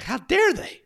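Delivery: sharp, high, loud mock outrage; indignant outburst. Friend retelling his anger, an imitated indignant outburst. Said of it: "I was angry 'cause I was like".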